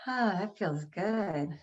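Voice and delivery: slow, soft sigh of relief; relieved exhale. Shown as a demonstration. After the breathing exercise with the audience, soft relief. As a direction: let the breath go and sink into it. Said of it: "enjoy this moment".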